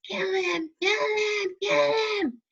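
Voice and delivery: very high yelling. A narrator's reading. Voldemort urging Quirrell to kill Harry, very high yelling. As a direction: yell it, high and frantic. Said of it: "Voldemort's yell".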